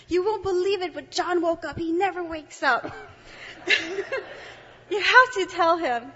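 high, loud excited news; excited voice of Maria. Retold or imitated.

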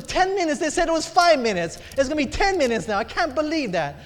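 high-pitched indignant protest; negative complaining. Retold or imitated. Lady in line reacting to a longer wait, complaining. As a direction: imitate an outraged customer in disbelief. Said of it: "she's going negative".

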